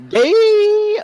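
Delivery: put-on high, loud childish shout; taunting yell. Sibling shouting the taunt again down the hall. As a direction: holler it from down the hall. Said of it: "as you guys hear".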